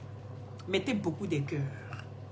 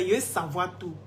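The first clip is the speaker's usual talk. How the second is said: ordinary delivery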